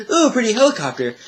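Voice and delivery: high, giggly ooh of wonder; mock starstruck coo; dreamy awe. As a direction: gush over it like a kid seeing something shiny. Admiring a helicopter in dream.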